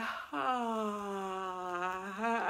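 long falling yawn on "ah"; drawn-out yawning sigh; forced yawn. Shown as a demonstration. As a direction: let a big yawn spill out. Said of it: "force that yawn".

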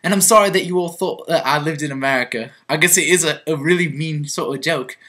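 put-on chatty London English accent. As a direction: confess in a lively southern English accent. Confesses to being English in changed accent.

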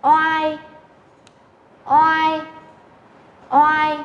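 "Oai" held high and level as one glide; model final sound. Shown as a demonstration. Teacher models next final for students, "Oai" drawn out. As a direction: stretch each "Oai" evenly on a high pitch. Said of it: "chúng ta sẽ đọc là".

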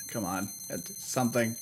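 ordinary delivery

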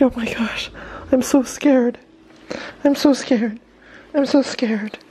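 very high, whispery scared-dog voice; mock-frightened squeak; Gatsby. Vlogger voices the scared dog for fun, mock fearful. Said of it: "He's like".

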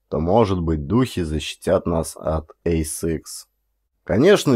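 usual speaking voice

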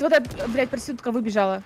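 ordinary delivery

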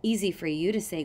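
ordinary delivery

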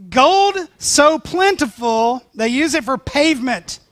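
slow, loud, laughing wonder at the gold; awed laugh. Real, not acted. Preacher to congregation, laughing in awe. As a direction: marvel at it, laughing.

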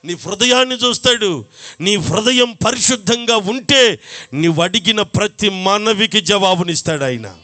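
ordinary delivery

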